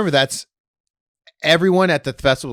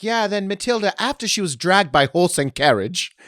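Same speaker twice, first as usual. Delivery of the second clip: higher, louder storyteller retelling; mock ghost-tour guide; 8 semitones higher, 4 dB louder than usual. Comic retelling Matilda's carriage death, mock tour guide. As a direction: play up the grisly tale. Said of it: "they were like".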